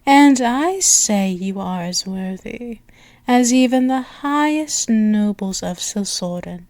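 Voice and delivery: ordinary talk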